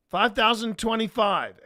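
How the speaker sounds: high, loud shout; shouted number. Retold or imitated. Third convict shouting his joke number, high loud shout. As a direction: call the number out to the block.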